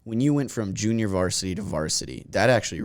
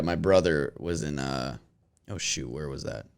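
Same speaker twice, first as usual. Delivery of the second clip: normal talking voice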